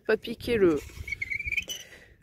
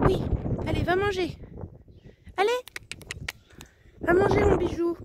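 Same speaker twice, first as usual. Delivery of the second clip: petite voix attendrie pour les poules; voix aiguë affectueuse; 10 semitones higher than usual. Real, not acted. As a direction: parler aux poules comme à des bébés. Urges hen to go eat. (voix de gazouillis affectueux)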